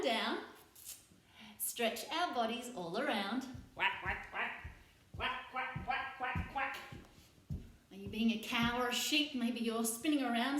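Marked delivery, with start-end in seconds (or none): 3.7-6.8 duck quacks. Shown as a demonstration. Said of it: "I'm gonna be a duck".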